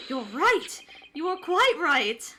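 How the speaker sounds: put-on a lightly done English accent, emphatic and slow; light English-accent exclamation, loud and drawn out. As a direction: play a hint of a posh English detective. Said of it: "saying words in an English accent really fast".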